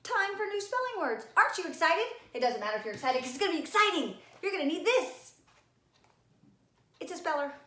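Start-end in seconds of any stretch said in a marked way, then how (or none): 0.0-2.1 high, bright sing-song greeting; cheery teacher hello. Acted. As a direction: perk the kids up for spelling day. Teacher's cheery opener to kids.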